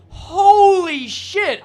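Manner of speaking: slow, loud and high disbelief; amazed exclamation. Real, not acted. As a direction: drag it out in awe. Amazed reaction.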